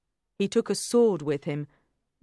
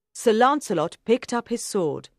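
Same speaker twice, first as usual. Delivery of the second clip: plain reading voice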